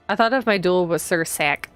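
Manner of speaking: ordinary talk, nothing marked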